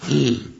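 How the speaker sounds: a quick mock gasp of surprise; startled gasp. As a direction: suck in air like you've just been caught. Peter spotted by young woman.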